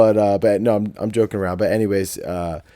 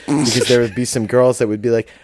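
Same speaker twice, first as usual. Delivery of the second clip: ordinary talk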